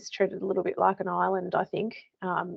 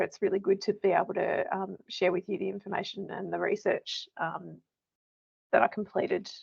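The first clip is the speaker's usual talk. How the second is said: ordinary talk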